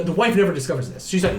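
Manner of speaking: ordinary delivery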